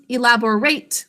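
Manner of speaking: ordinary talk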